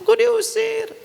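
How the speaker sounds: very high, drawn-out hammed-up wail; mock crying. Wife running home to mother, mock crying. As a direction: wail it like a badly hurt child, play-acted. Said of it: "Dia pulang nangis".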